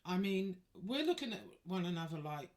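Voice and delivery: ordinary delivery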